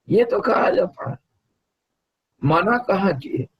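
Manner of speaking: high-pitched, animated insistence; emphatic insistence. Real, not acted. Guru assures student nothing was ever forbidden, insisting.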